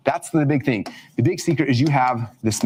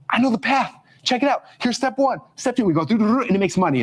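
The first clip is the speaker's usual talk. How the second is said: excited; 8 semitones higher than usual. Retold or imitated. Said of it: "I'm like".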